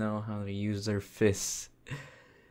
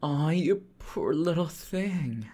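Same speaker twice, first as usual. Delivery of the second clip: cooing pity; 6 semitones higher, 4 dB louder than usual. Acted. Demon consoling a loyal little servant, cooing pity. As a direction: coo over a poor little pet.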